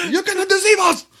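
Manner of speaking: rally the plane against the crew; defiant old man. Retold or imitated. Repeating the old man's cry.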